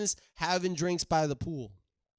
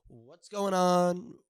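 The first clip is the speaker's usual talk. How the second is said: ordinary talk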